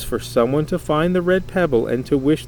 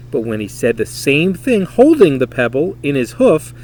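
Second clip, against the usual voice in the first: usual speaking voice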